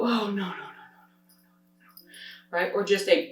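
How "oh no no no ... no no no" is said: quiet run of gentle nos; soft, rapid string of no; soft repeated no. Shown as a demonstration. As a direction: trail off shaking your head. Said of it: "Or you can go".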